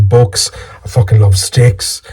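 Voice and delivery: normal talking voice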